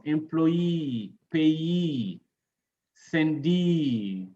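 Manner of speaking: employee, payee, sendee with long stressed final "ee". Shown as a demonstration. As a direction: lean on the last syllable of employee, payee, sendee. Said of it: "Stress E, double E in nouns".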